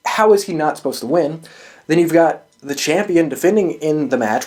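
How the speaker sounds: ordinary delivery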